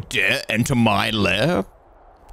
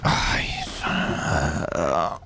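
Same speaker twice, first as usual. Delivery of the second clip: muttered curse; 7 semitones lower, 4 dB quieter, slower than usual. Real, not acted. Frustrated at fall.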